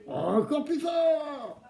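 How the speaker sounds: high, slow drawn-out call; kids show host. Acted. (animateur qui encourage les enfants à crier plus fort)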